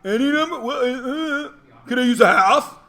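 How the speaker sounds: confused student. Pretend. Teacher voices confused student.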